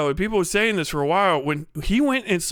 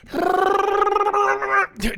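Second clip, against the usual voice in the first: long, high rolled whirr; charging-up noise; 12 semitones higher than usual. Acted. Imitating Tarantino charging up for the role, revving noise. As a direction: rev yourself up with a sustained high trill.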